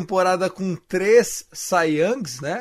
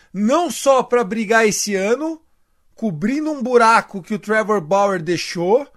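ordinary delivery

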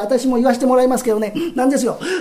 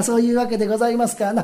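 ordinary talk

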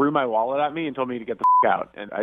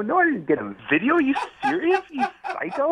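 much higher, louder, disbelieving; incredulous outburst; 3 dB louder than usual. Real, not acted. Guy to ex who asks for a video, incredulous high outburst. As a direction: shoot back in total disbelief.

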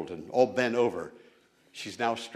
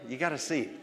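usual speaking voice

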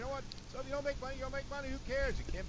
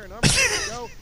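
much higher, much louder shout; angry shout; 14 semitones higher, 13 dB louder, faster than usual. Real, not acted. Host ranting to co-hosts about the setup, high loud shout. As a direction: blast it out in fury.

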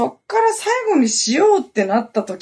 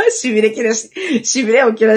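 laughing; 3 semitones higher, 4 dB louder than usual. Real, not acted. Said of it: "laughs at the story".

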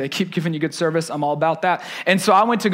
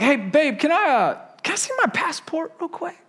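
rising higher into a timid, hushed ask; sheepishly sneak the question in; nervous husband fishing for his passport; 8 semitones higher than usual. Retold or imitated. Sheepishly asking wife for passport.

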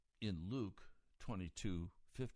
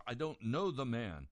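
louder, forceful oath of denial; emphatic denial; 4 semitones higher, 7 dB louder than usual. Acted. Peter loudly swears to the girl he doesn't know Jesus. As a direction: swear it out hard and loud. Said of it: "he denies it with an oath".

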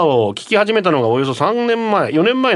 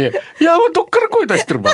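very high, hammed-up cooing voice; put-on sugary falsetto; mock coaxing voice; 13 semitones higher, faster than usual. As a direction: squeak it out in exaggerated baby-talk pitch. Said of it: "ていうぐらい猫なで声でキモい".

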